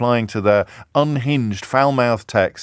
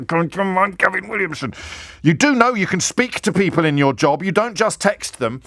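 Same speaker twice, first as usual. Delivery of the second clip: lecturing; 6 semitones higher than usual. Retold or imitated. Gavin's scolding text.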